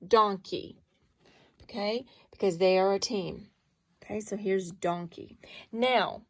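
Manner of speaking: ordinary talk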